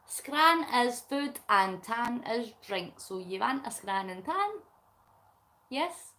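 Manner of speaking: normal talking voice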